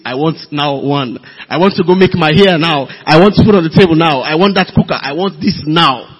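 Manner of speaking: mock demands, loud and insistent; impatient demanding. Brother mimics women who want it now, loud impatient demands. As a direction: rattle off wants with growing force.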